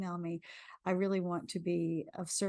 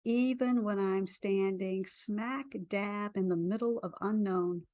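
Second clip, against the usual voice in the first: guided affirmation. Acted. Leads a tapping affirmation.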